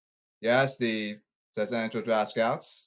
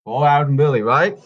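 loud and punchy, each word hit; 11 dB louder, much faster than usual. Shown as a demonstration. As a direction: call it out loud and firm. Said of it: "Too slow".

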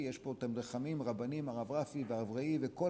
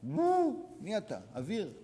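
scornful exclamation; 6 semitones higher, 4 dB louder, slower than usual. Acted. Mocking the unforgiving person.

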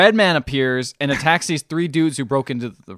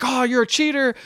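mock accusation; 11 semitones higher than usual. Acting out an accusation.

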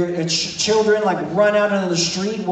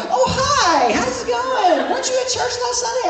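much higher, fast sugary greeting; sweet fake-nice mom. Mother caught scolding greets the pastor, sugary high voice. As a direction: switch instantly to charming politeness. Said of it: "And they're like".

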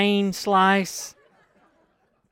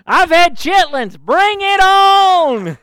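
long, high, drawn-out shout; bravado holler; 13 semitones higher, 10 dB louder, much slower than usual. Retold or imitated. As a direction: holler it like a fearless challenge, stretching the words. Boasting he can eat anything.

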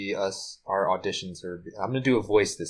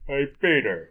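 put-on cartoon buddy greeting; higher, playful celebrity impression; Patrick Warburton; 5 semitones higher than usual. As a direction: call out as a cartoon pal. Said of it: "You're Patrick Warburton".

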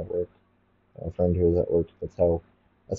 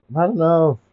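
higher, louder fed-up cry; raised exasperated cry; 10 semitones higher, 4 dB louder than usual. Acted. Repeated, raised.

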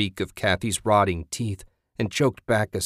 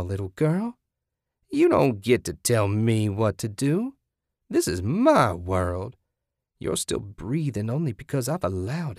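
domineering, menacing zealot; 6 semitones higher than usual. Acted. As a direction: play a crazed captor lording it over a victim.

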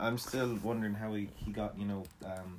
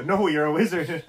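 louder, hammed-up insistence; mock Hagrid voice, pushed louder; insisting; 7 semitones higher, 12 dB louder than usual. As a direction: insist it again, loud and playful.

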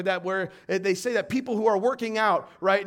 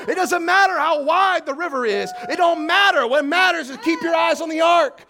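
full-force preaching; 9 semitones higher, 9 dB louder than usual. Acted.

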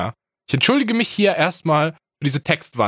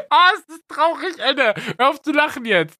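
ordinary delivery

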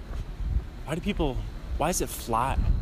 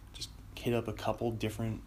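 whispering; 12 dB quieter than usual. Real, not acted. Quiet aside, muffled in apartment.

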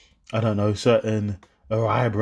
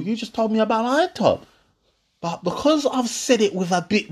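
put-on higher hurt girlfriend voice; mock girlfriend; 6 semitones higher than usual. Girlfriend complaining he went too far, higher mock hurt. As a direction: play her offended, higher.

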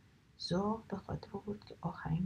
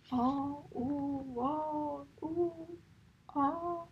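drawn-out pained wails rising higher and louder; pained cries; 7 semitones higher, 4 dB louder, much slower than usual. Acted. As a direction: yelp in pain, slowly climbing up. Seagull hurts feet on thorns.

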